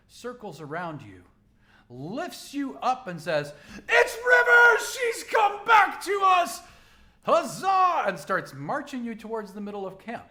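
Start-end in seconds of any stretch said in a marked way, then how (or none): 3.9-8.0 high, loud joyful cheering shout; crowd shouting. Acted. Cosplay crowd cheering River's return, shouting high and loud.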